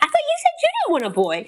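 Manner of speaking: very high, rushed mock protest with a laugh; flustered comeback. Wife throwing husband's words back at him, flustered high squeal.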